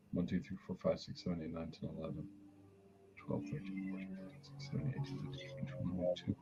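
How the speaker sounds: murmured count in a ritual; whispered counting. Real, not acted. Medium counting quietly during a cord removal. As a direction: count under your breath, fading to a whisper.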